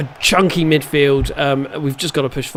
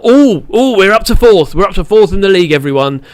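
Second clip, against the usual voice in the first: high, louder burst of glee at the league table; excited cheer; 4 semitones higher, 7 dB louder than usual. Real, not acted. Gamer telling viewers his team climbed the table, delighted. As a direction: announce the good news with a delighted rise.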